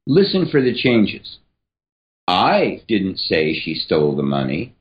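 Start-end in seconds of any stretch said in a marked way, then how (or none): 2.3-4.8 I punched with a rise; emphasis on "I"; stress on I. Shown as a demonstration. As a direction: lean hard on the word I. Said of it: "emphasis here is going to change every single time".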